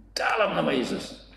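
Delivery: a high, ringing command in Jesus' name; commanding exorcism. Retold or imitated. Father commands the demon to leave in Jesus' name. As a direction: cast the demon out with authority.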